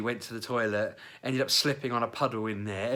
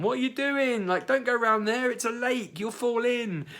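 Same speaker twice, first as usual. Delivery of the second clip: high, loud shouted warning; shouting out; 5 dB louder than usual. Retold or imitated. Claire warning colleagues by the lake, high loud shout. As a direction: call out to stop them near the lake. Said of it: "she shouted out to them".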